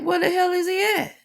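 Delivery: very high-pitched exasperated demand; indignant parent. Retold or imitated. Parent asking where the missing son is, indignant.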